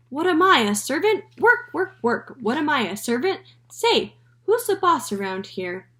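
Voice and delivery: high, loud squawky parrot voice; cheeky parrot. A narrator's reading. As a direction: squawk it with sass. Parrot Penelope talking.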